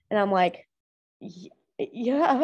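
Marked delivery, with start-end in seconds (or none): 1.2-2.4 halting, voice catching on the yes; a teary, stumbling yes; choked-up yes. Retold or imitated. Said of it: "Then I start crying".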